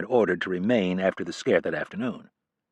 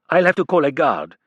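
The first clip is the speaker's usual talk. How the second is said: put-on louder, higher French-accented threat; threatening, French accent; 6 semitones higher, 6 dB louder than usual. A narrator's reading. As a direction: warn her off in a heavy French accent. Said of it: "he threatened in his thick accent".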